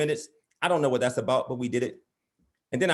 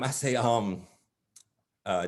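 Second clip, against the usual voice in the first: ordinary talk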